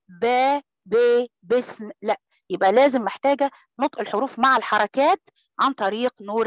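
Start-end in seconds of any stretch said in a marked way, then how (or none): 0.1-0.6 با cut short and rushed; wrong clipped ba. Shown as a demonstration. Teacher showing students the wrong ba, clipped. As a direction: show the clipped mistake on با. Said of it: "ما ينفعش أقول".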